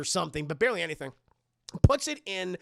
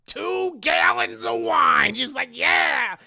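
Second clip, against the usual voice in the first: loud, slow, higher shout; mock shout; 8 semitones higher, 9 dB louder, much slower than usual. Comedian hamming up the wine purchase, loud slow shout. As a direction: bellow it for comic effect. Comic exaggeration of wine buying.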